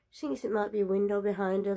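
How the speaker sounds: ordinary delivery, nothing marked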